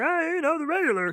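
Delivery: high, easygoing vendor's answer; imitated vendor reply. Hot dog seller answering a regular customer, imitated high voice. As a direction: mimic a seller's singsong small talk.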